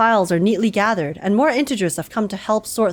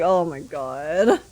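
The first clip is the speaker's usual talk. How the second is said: exasperated groan; 6 semitones lower, 4 dB quieter, much slower than usual. Retold or imitated. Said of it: "He's like".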